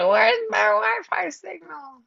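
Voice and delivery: playful self-impression of a frantic wife; mock freaking out. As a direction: imitate yourself fretting over the Wi-Fi. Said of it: "I don't say it exactly like that".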